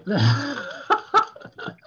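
high, loud burst of laughter; embarrassed laugh. Real, not acted. Poet cracking up after fluffing the line, laughing. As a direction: laugh at your own slip.

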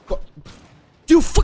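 sudden high, loud curse; angry shout. Real, not acted. Gamer cursing a lucky opponent, angry shout. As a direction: bark it at the screen.

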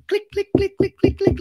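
sharp imitated heel clicks; high, loud clicking noises. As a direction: tick out tiny quick footsteps by mouth. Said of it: "little heels".

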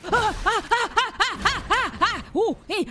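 laughing panic. Real, not acted. Laughing panic while fighting.

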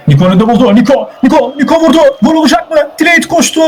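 rapidly climbing pitch to a high peak; excited play-by-play. Real, not acted. Commentator calling Delete's last-second save, excited. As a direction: build urgency up to the last-moment save.

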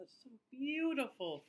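usual speaking voice

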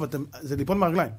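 ordinary talk

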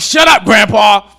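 loud, high mock shout; shouting at grandpa. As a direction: yell it down the table to silence grandpa. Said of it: "And they're like".

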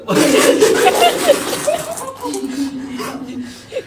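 drawn-out amused laughter; long laugh. Real, not acted. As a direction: laugh at the slip. Laughs at mistake.

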